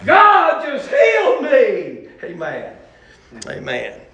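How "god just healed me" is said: high, drawn-out preacher's cry; imitated tearful holler, stretched out; retold weeping cry. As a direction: cry it out slowly, acting overcome. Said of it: "I began to weep and say".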